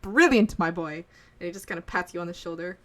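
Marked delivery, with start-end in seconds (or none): 0.0-1.0 much louder, much higher joyful cry; delighted outburst. Acted. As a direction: burst out with delight at success. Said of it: "you hear him go".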